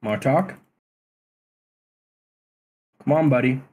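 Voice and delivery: urgent call to a friend. Acted. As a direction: raise voice to snap him out. Calling to transfixed companion.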